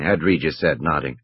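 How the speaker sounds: ordinary delivery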